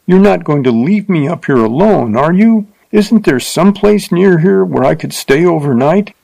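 begging not to be left alone; pleading in fear. A narrator's reading. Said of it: "she cried".

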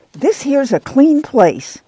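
a mother laying down the rule, slowly; Ma, firm and proud. Acted. Ma scolding in the story.

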